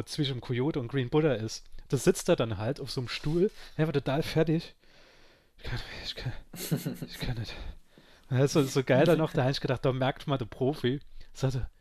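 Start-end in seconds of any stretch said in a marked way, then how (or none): 5.6-7.5 breathy, fading whisper of a wreck; barely able to speak; feeble drunk whimper. Retold or imitated. Drunk Giovanni slumped on a chair outside, feeble whimper.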